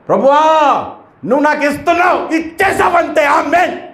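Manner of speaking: hammed-up shouting at God; mock shouting prayer. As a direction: yell at God as if demanding. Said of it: "దేవుడి మీద కేకలేస్తాడా".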